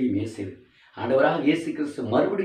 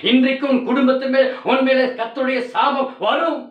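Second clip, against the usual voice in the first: high, loud fiery sermon; preaching at full force; 13 semitones higher, 7 dB louder than usual. Acted. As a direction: thunder the warning at the congregation. (உரத்த பிரசங்கக் குரல்)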